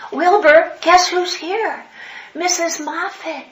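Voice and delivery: high, loud, slow call. Retold or imitated. Calling to a dying man in hospital, loud and drawn out. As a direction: draw it out to wake him.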